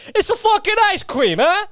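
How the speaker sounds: put-on Italian accent. Ranting to viewers about ice cream, in a hammed-up Italian accent. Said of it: "I just turned into most, the most Italian".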